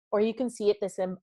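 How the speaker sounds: normal talking voice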